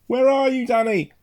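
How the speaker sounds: high, loud searching call. A narrator's reading. Father calling to find his son, calling out. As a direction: call across the wood to a child. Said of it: "my father called out".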